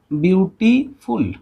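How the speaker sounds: slow, syllable-by-syllable "beautiful"; शब्द तोड़कर बोलना. Shown as a demonstration. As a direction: say "beautiful" slowly in three separate parts, view, टी, फुल. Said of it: "अभी आप एक लिखिए". ("Beautiful" को धीरे-धीरे तोड़कर बोलें)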